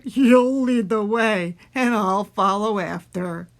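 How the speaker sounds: loud, slow monster voice; Gruffalo voice. A narrator's reading. Gruffalo agrees to follow mouse, loud slow monster voice. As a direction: play a big lumbering beast. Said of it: "said the Guffalo".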